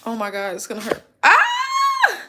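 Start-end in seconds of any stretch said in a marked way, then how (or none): 1.2-2.2 high sharp scream of pain; real shriek as wax pulls. Real, not acted. Ripping wax strip.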